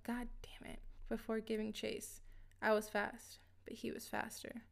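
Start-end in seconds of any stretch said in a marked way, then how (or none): 0.0-0.8 whispered, under his breath; muttered curse. Acted. Agent mutters the curse in a whisper. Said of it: "heard the man mutter something like".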